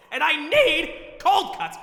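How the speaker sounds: slow, very high desperate whine; desperate pleading. Acted. Shopper who can't live without cold cuts, desperate high plea. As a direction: stretch out the desperate need, high-pitched.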